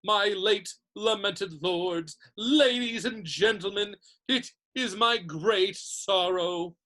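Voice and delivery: slow, grandly solemn speech; formal oration. A narrator's reading. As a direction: address the crowd with stately, drawn-out gravity. Nick begins a solemn podium speech.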